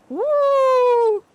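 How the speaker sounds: one very high, loud held hoot; obnoxious owl hoot. Retold or imitated. Defending her impression to Vic, loud high owl hoot. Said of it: "I was going for obnoxious".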